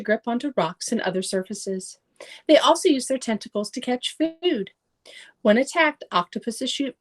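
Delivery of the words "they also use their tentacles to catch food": ordinary delivery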